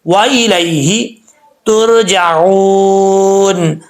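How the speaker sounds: वा इलाही तुरजाऊन drawn out very slowly; slow recitation. Shown as a demonstration. Teacher reciting the verse slowly for students. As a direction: recite each syllable of तुरजाऊन long. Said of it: "recitation demo".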